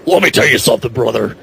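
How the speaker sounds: a lightly done Hulk Hogan wrestling-promo voice; a podcaster doing Hogan's "let me tell you something, brother" bit; Hulk Hogan impression. Podcaster joking to co-hosts, lightly imitating a wrestler's promo voice. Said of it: "Hulk Hogan's on top of it".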